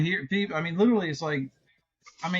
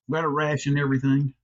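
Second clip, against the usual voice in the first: ordinary talk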